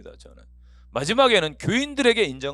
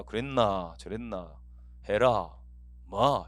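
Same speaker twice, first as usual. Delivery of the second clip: low, curt Gyeongsang speech; gruff Gyeongsang dialect; 6 semitones lower, much slower than usual. Retold or imitated. Gyeongsang man talking bluntly, imitated gruff dialect. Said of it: "남자들 무뚝뚝합니다".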